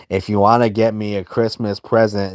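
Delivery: normal talking voice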